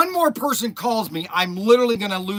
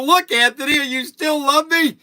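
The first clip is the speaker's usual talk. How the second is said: teasing excited; 8 semitones higher, 8 dB louder than usual. Retold or imitated. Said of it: "and I go".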